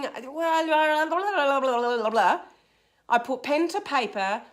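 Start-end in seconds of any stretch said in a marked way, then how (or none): 0.0-2.4 run-together blah-blah gibberish; mock mental babble. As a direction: babble like thoughts spinning in your head. Imitating own muddled thoughts.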